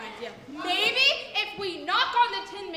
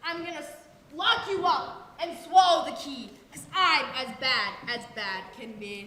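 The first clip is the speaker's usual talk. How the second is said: lower, wicked rhyme; wicked witch threat; 7 semitones lower than usual. Acted. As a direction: relish being bad.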